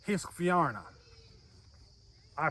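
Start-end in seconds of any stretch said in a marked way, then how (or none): 0.1-0.8 "Hiskvarna" with an "ih" vowel, Swedish style; a hammy Swedish "Hiskvarna"; a mock Swedish "Hiskvarna"; Swedish accent. Shown as a demonstration. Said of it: "my horrible Swedish accent".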